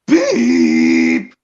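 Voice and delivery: a deep, loud booming beep; Brandon's loud beep. Retold or imitated. Kid imitating the narrator, loud low beep. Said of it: "for Brandon goes".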